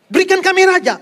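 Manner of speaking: loud insistent plea for a king; demanding people. Retold or imitated. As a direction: demand it like a stubborn crowd.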